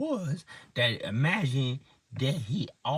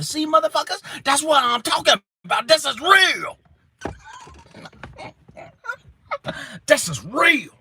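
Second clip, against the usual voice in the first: louder, higher, emphatic; excited insistence; 10 semitones higher, 4 dB louder than usual. Real, not acted. Telling the listeners it is real, loud excited.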